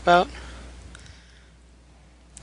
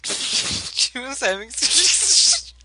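laughing; 8 semitones higher, much slower than usual. Real, not acted. Repeating through laughter.

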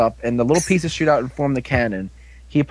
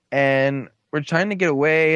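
usual speaking voice

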